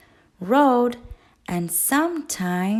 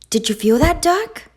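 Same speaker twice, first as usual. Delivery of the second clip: rising, louder with excitement; excited goose; 6 semitones higher, 5 dB louder than usual. A narrator's reading. Goose asks Duck about the egg, thrilled. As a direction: Goose can't believe what she just felt.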